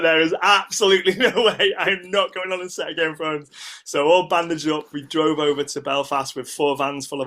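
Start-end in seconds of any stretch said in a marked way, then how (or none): 0.0-3.5 raised, louder indignant protest; retold adamant objection, pitched up; emphatic refusal. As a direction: insist with mock outrage. Retold defiant refusal to stay home.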